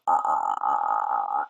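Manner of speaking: very low creaky fry; vocal fry. Shown as a demonstration. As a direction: effortless crackling fry. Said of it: "there is no effort".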